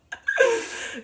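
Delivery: high-pitched real laugh; laughter. Real, not acted. Speaker reacting to her own talk, high laugh.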